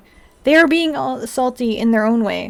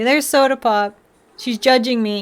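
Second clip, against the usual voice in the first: ordinary delivery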